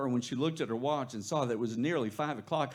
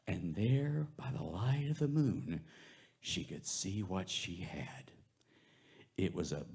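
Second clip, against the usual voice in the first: spooky hushed narration; 6 semitones lower, 5 dB quieter than usual. A narrator's reading. Spooky storytelling in graveyard.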